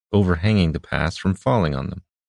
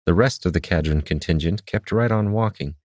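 ordinary delivery

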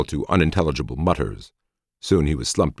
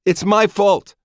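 high, loud anguished confession; guilt-stricken cry; 6 dB louder, slower than usual. Acted. Guilty psychotech begging.

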